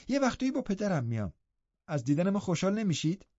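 half-scolding, half-cuddling Ellen; mock-cross young girl; teasing scold. A narrator's reading. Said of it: "با نیش و نوازش پرید به من".

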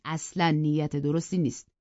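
ordinary delivery

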